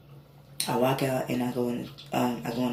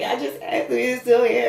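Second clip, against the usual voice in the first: high and louder, thrilled; telling the twins what just happened; amazed disbelief; 9 semitones higher, 6 dB louder than usual. Retold or imitated. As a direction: blurt it out, stunned. Retelling awe at God's answer.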